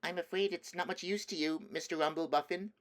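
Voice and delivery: put-on a kind little girl apologizing gently; Lucy speaking kindly to the giant. Lucy's voice.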